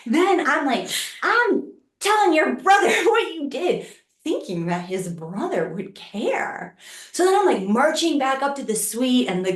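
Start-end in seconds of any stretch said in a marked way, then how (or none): 1.2-3.9 high, tattling indignation with a laugh; indignant retold threat. Woman threatening to tell attacker's brother, high mock indignation.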